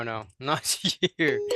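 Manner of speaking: ordinary talk, nothing marked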